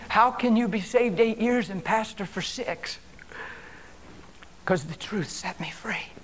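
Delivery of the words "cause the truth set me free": soft, breathy near-whisper; hushed testimony. Real, not acted. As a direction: answer your own question in a hush. Hushed answer to his own question.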